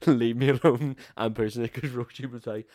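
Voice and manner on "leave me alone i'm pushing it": loud, higher, laughing mock possessive yell; laughing loud refusal. Refusing to hand over the wheelchair, loud laughing mock yell. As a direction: bark it through laughter, half joking. Fighting to keep pushing chair.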